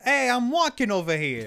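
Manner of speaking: put-on loud, high-pitched New York Italian wise guy accent; hammed-up New York street accent, hollered; exaggerated New York Italian-American shout. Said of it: "it's, like, very Italian influence".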